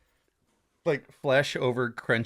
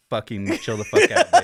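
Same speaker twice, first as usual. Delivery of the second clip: mock tough cursing; 2 semitones lower, 4 dB louder than usual. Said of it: "I'm trying not to curse".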